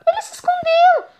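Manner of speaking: high squealed mock gasp; mock surprise. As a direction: fake shock for the viewers. Mock surprise at hiding friend.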